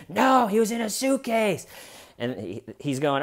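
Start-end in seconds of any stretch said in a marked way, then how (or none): 0.1-1.7 high, loud imitating insistence; mock loud insistence. Imitating her answer to the firefighter, high insistent. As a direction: press it, still higher. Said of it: "She gets loud real quick".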